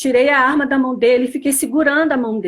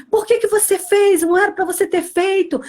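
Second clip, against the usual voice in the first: husband's scolding; 6 semitones higher than usual. Retold or imitated. Husband scolding her again.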